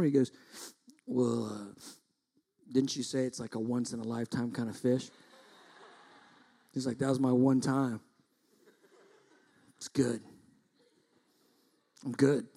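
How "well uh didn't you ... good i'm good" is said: softer, unhurried and unbothered; laid-back refusal. Retold or imitated. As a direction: imitate someone happily done for the day. Son contentedly declines more fishing.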